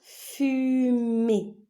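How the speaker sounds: "Fumée" as FU-MÉE, high and loud; syllable-by-syllable. Shown as a demonstration. Teacher naming a picture for pupils, syllable by syllable. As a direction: draw out each syllable of "Fumée".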